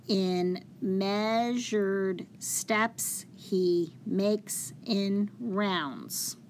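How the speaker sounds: very slow, measured reading of the verse; spaced-out syllables for counting. Shown as a demonstration. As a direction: read it out one syllable at a time. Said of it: "when I read each syllable".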